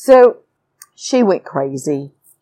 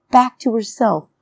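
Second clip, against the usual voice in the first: normal talking voice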